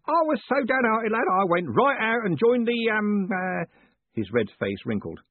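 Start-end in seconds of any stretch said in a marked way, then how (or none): 0.1-3.7 put-on old Parker roaring. A narrator's reading. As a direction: roar it like a deaf old soldier.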